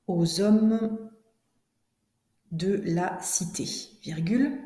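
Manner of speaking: slow, carefully articulated chunks with long pauses; classroom dictation. Shown as a demonstration. Teacher dictates to pupils writing it down, slow deliberate dictation. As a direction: dictate phrase by phrase, punctuation spoken aloud.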